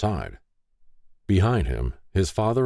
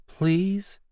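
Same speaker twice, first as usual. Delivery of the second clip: much higher, pleading woman's voice; soft pleading. A narrator's reading. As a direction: beg gently and softly. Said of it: "she softly pleaded".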